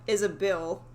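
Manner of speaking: ordinary delivery, nothing marked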